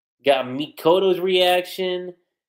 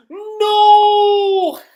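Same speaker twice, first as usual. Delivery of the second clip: high, loud drawn-out cry of pain; anguished scream; 11 dB louder than usual. Retold or imitated. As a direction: scream it as flames take him. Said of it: "he just screams".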